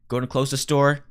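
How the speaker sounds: ordinary talk, nothing marked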